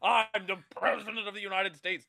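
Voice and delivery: loud, high, indignant movie president; jowls flapping. Acted. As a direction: bluster like an affronted president. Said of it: "jowls flapping".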